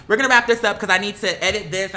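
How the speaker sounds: normal talking voice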